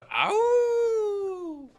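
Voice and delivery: long high howl, shouted loud; wolf howl. Pretend. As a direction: throw your head back and howl like a wolf. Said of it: "give me your best wolf howl".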